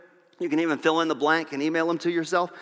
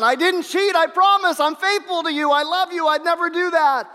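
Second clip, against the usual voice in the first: desperate pleading husband; 9 semitones higher than usual. Retold or imitated. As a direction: beg her to believe you, pitched way up.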